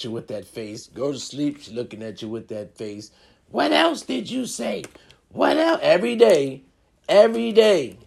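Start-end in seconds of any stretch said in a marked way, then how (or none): 3.5-5.8 high, slow mock interrogation; nagging partner. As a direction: press for answers in a raised partner voice. Acting out partner's repeated interrogation.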